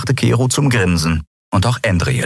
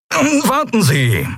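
high, drawn-out call; stretched, high-pitched plea to wait; much slower than usual. A narrator's reading. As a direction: call after someone to stop them. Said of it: "rief Delarco".

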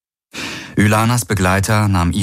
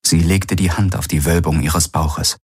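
plain reading voice

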